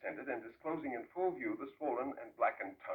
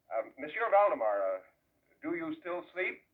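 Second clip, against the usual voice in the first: calling to the sleeper; 6 semitones higher, 5 dB louder than usual. Acted.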